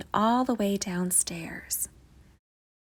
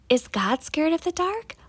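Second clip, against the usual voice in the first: put-on curious little boy; 8 semitones higher, 3 dB louder than usual. Child asks mother.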